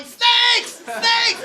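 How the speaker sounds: very high, loud mock-panic yell. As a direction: scream it in terror, hammed-up. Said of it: "I'm just yelling".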